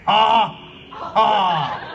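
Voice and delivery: loud mock villain ha ha; hammed-up evil laugh. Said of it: "Mew three dodged balls and laughed".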